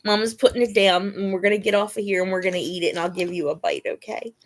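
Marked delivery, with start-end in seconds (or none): none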